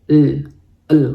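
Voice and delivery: a soft palatal l; soft L sound. Shown as a demonstration. Teacher demonstrating the soft Russian l for students. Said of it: "lidah kalian diangkat ke atas".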